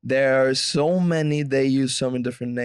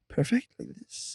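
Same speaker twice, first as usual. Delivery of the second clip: whispered, slow impression; Jeff Goldblum; 8 dB quieter, slower than usual. Co-host mimicking the actor's hand gestures, slow whispered impression. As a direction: whisper it slowly and deliberately. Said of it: "it would be like".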